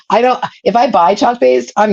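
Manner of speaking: laughing through the words; giggly, amused aside. Real, not acted. Amused aside about using paste.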